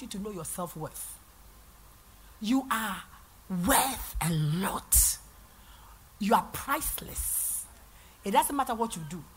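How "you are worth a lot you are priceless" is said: slow, soft, near-whispered; tender affirmation. Real, not acted. Pastor telling every woman her worth, slow and soft. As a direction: lean in and say it gently and slowly.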